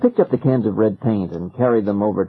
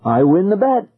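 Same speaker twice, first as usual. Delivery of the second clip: high, gleeful and triumphant; cried happily; 8 semitones higher than usual. A narrator's reading. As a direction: crow about winning the bet. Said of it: "Ben cried happily".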